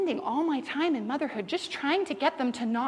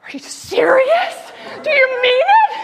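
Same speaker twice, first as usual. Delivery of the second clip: much higher, louder, laughing disbelief; thrilled child; 13 semitones higher, 13 dB louder than usual. Retold or imitated. Daughter amazed her mother will clean up, thrilled and laughing. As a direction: squeal it like a delighted little girl. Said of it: "She goes".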